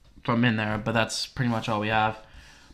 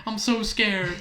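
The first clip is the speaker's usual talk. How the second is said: high, hurried mock panic; frightened whine, retold; scared urging; 8 semitones higher than usual. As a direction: imitate pleading with a friend to hurry. Acts out frightened urging at fence.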